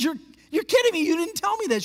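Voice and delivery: high, fast incredulous protest; can't believe she left it out; happy shock, almost laughing; amazed disbelief. Retold or imitated. Pastor amazed she never told him.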